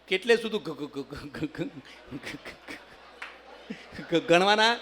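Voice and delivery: stuck on ગ-, fading; mock stammer. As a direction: stammer the question. Stammerer asks pilot.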